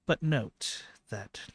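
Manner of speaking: lightly voiced goddess, long pauses; solemn goddess. Game master plays goddess Anwa.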